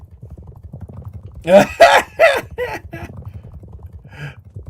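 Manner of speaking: long shrieking laugh; high loud cackle. Real, not acted. Host laughing hard at the race. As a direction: howl with laughter.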